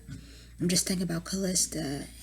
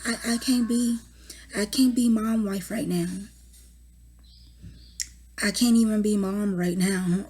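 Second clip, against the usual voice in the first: overwhelmed outburst; 3 semitones higher, 5 dB louder than usual. Real, not acted. Overwhelmed mother venting about hospital.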